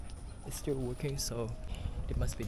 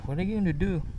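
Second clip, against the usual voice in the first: a bit higher, playful ask; playful question; 7 semitones higher, 5 dB louder, faster than usual. Real, not acted. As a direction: tease the cat gently. Teasing the cat.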